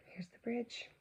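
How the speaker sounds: soft rising whisper; a hushed discovery. Real, not acted. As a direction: point out the bridge in a hush. Whispered pointing out picture.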